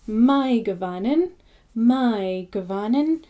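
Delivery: my said like English my, not may; my gavanin, my rhyming with dine. Shown as a demonstration. Teacher modelling my gavanin as a greeting. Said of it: "it's my".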